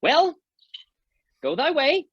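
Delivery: high, loud angry cry; angry outburst. Acted. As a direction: fling the words after her. Oberon angry as Titania leaves.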